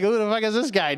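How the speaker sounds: high-pitched acted disbelief; mock bewilderment. Coworker reacting to a supposed stranger, mock bewildered. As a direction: play at not knowing who this is.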